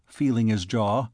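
usual speaking voice

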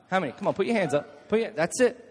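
ordinary talk, nothing marked

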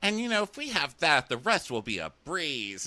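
a touch of starry-eyed romance; lightly mocking dreamy girl. Mocking Cassandra about her date, slightly high and earnest. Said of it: "And Cassandra's like".

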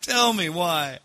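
high, laughing self-reproach; mock lament. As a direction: wail it in mock despair at your own blunder. Mock lament over his blunder.

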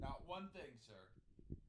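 high, soft mocking echo trailing off. Reporter mocks the guest's answer, high and fading.

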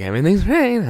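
cheerful with a creepy edge. Retold or imitated. Psycho coworker after skinning a cat, creepy chirpy. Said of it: "This guy goes home and skins a cat".

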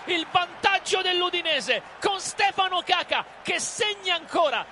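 excited goal commentary; live play-by-play. Acted.

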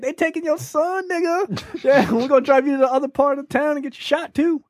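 high, gleeful sneering cop voice; mock the cruel cop with a cackle; mock callous cop. Imagining a heartless cop taunting the father, high sneer. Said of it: "he's not like".

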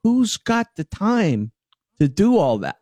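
high, slow, drawn-out disbelief; incredulous retort. Retold or imitated. To his wife about selling old clothes, slow incredulous scoff. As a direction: stretch out the scoff at the idea. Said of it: "I'm like".

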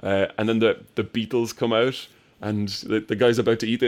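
ordinary talk

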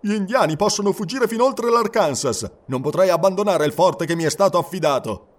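a captain refusing firmly; stern fort captain. Acted. (capitano severo, voce alta e decisa)

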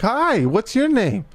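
high, slow singsong greeting; friendly doctor. Retold or imitated. Doctor greeting a young boy, imitated friendly singsong. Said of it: "and she's like".

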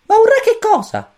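very high, squeaky little chick voice; cosmic chick voice. A narrator's reading. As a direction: chirp it like a tiny alien chick. Pulcino cosmico. (vocina acutissima da pulcino)

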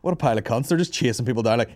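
ordinary talk, nothing marked

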